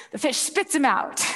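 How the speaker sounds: normal talking voice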